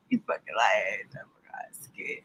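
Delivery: hushed whisper aside. Real, not acted. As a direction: whisper it under the breath. Quiet aside after fake fight.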